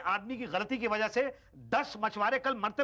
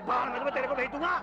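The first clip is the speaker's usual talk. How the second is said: angry threat; 6 semitones higher, faster than usual. Acted. Fisherman furious at official.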